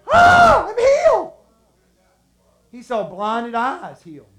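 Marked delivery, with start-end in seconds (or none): 0.1-1.3 very high, loud shriek of amazement; gasp-shout it like someone just cured; ecstatic shout. Acted. Leper reacting to being healed.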